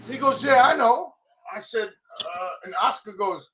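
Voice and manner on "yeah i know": higher, louder careless reply; loud breezy imitation. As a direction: toss it off like it's no big deal. Ish quoted, breezy loud reply.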